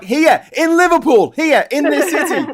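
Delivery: exaggerated Scouse insisting on home; broad Scouse. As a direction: lay the Scouse accent on thick. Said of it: "I'm like".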